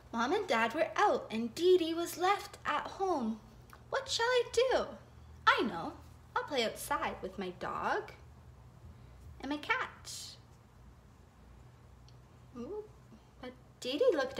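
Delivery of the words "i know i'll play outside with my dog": usual speaking voice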